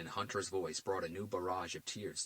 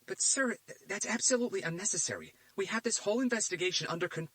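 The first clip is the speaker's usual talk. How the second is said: higher, louder, stumbling objection; flustered protest; 13 semitones higher, 6 dB louder than usual. A narrator's reading. Agent Fisher objecting to the director, flustered. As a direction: plead against it.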